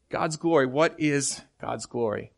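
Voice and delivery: normal talking voice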